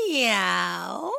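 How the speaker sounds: playful imitated meow, rising; cat meow. Shown as a demonstration. Host answering what a cat says, meowing. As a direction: meow like a kitty for kids. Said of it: "So what does a cat say?".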